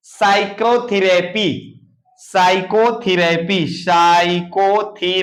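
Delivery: Psychotherapy spoken slowly in separate syllables; measured, drawn-out psychotherapy with a silent p; slow pronunciation demo. Shown as a demonstration. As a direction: sound out psychotherapy unhurriedly for learners. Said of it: "इसको क्या पढ़ेंगे?".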